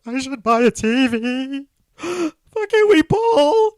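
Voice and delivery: high, loud gasping mock sob; mock sobbing. Retelling sobbing at Walmart while buying a TV, hammed-up sob. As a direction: wail it like a fake crying breakdown. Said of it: "I was sobbing at Walmart".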